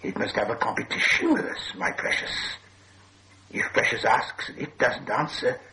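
hissing, high creature voice; menacing Gollum. A narrator's reading. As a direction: hiss the threat, flaring high at the end. Gollum challenges Bilbo to riddle contest.

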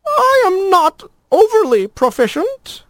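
very high, prim lizard voice; wobbly lizard voice. A narrator's reading. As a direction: speak like a fussy, timid lizard. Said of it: "came the wobbly voice".

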